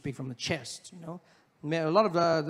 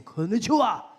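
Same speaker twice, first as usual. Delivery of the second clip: strong Japanese; 5 semitones higher, 6 dB louder than usual. Retold or imitated. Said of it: "everything in Japanese is strong".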